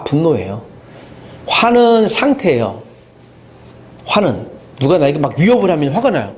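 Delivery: usual speaking voice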